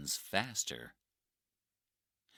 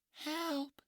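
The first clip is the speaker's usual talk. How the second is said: distant cry for help. Acted. Trapped woman calls out.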